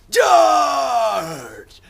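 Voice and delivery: high, drawn-out hammed-up war shout; battle cry. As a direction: bellow a mock cavalry charge. Mock battle cry of Jack.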